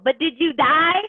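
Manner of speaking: high, loud Chow quote; movie catchphrase. Retold or imitated. Quoting the Hangover line to the team, high and loud. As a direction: fling the movie line out, laughing. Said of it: "our new quote in our household".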